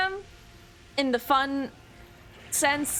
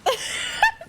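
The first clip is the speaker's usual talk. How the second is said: high squeal laugh. Real, not acted.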